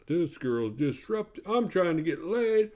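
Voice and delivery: high, drawn-out complaining dude voice. Retold or imitated. Jackass friend complains about the freak-out, put-on dude voice. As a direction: imitate a griping party bro. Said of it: "And T J's like".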